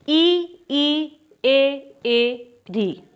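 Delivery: ई ई ए ए री each vowel sounded out separately and clearly; front vowel demo. Shown as a demonstration. Teacher showing students the front vowels, each sounded out distinctly. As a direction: model each vowel ई, ए, री slowly for the class. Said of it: "ध्यान से देखना है".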